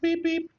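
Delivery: high loud "beep, beep"; car horn beep. Pretend. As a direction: honk it like a horn.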